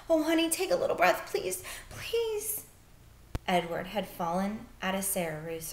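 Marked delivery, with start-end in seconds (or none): 0.1-2.6 desperate whisper. Acted. Bryce begging dead sister.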